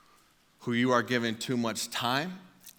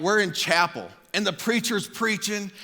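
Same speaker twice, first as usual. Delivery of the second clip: ordinary talk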